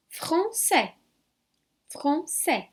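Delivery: "français" with silent ending, fran-sè; French pronunciation. Shown as a demonstration. Teacher models "français" for students. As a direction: say "français" as fʁɑ̃sɛ. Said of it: "a gente tá vendo aqui".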